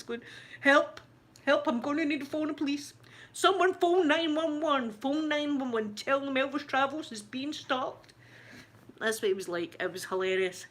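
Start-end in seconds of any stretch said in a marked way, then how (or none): 0.6-8.1 mock cry for help. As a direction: beg for help like a panicking coward. Mocking Elvis crying for help.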